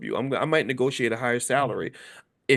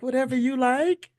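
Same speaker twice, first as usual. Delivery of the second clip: high, far-off dreamy voice; sweet and eager to please; mimicking the trained bride; submissive bride; 11 semitones higher than usual. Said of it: "She's like".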